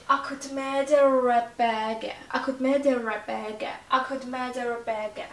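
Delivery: "Akut med er bege" very slow with "er" drawn out; linking R. Shown as a demonstration. Teacher slows the phrase for students to hear linking. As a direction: stretch "er" so the linking R is heard.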